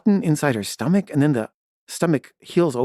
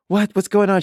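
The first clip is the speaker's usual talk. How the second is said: retold higher-pitched bewildered question; confused, freaked out; 8 semitones higher, 4 dB louder than usual. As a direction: imitate a freaked-out girlfriend, pitched up, not shouted. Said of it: "really confused and freaked out".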